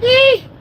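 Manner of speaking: a high, loud delighted squeal. Retold or imitated. Admiring his girl's body, a high loud squeal. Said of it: "I was like".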